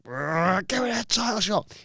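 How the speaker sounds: high-pitched, hammed-up demand after a trilled brrr; mock fierce demand. Challenger demanding a title shot over trapped ankle, mock fierce. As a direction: imitate a worked-up wrestler, voice pushed high.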